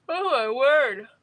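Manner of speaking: talking through a yawn; mid-yawn, words stretched. Real, not acted. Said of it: "Why am I yawning?".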